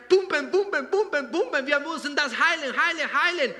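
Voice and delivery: high, loud, urgent chant of pumpen; hammed-up heart urging the body on. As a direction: play the heart pumping hard to heal. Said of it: "Und sagt, der Herz".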